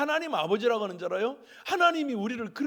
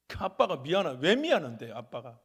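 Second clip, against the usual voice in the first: ordinary talk, nothing marked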